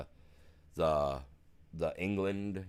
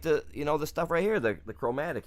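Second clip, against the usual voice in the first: high, a little louder, fumbling for the word; mock flustered self; 8 semitones higher, 5 dB louder than usual. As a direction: play his younger self grasping at "chromatic". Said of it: "I was like".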